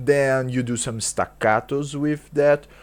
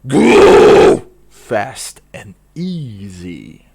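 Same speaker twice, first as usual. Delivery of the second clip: growled intro; 10 dB louder, much slower than usual. Shown as a demonstration. Growled vocal lesson intro.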